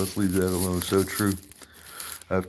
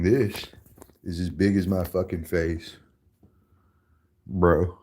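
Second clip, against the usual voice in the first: ordinary talk, nothing marked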